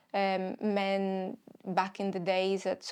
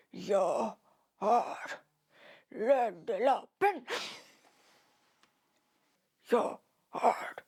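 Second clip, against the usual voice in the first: Swedish Batman voice; slower than usual. Pretend. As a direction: drag out Lederlappen like a brooding Batman. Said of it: "I have to find my Batman voice now".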